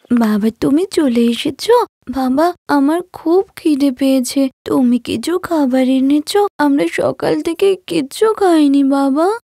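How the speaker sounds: put-on a starving five-year-old begging her father for food; hungry little girl. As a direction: daughter greets returning father, hungry and childlike. Hungry child greets father.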